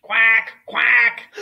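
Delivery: two loud, blaring quacks; duck quack. Acted. George the pup answering his mother, loud duck quacks. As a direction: honk it out like a duck. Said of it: "And George went".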